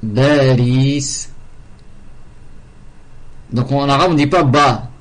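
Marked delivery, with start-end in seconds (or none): 0.0-1.3 Baris with a b for the p, the Arabic way; Paris said as Baris; arabized Paris. Shown as a demonstration. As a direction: say Baris, b not p. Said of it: "c'est Paris".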